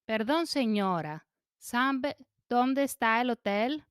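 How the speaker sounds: usual speaking voice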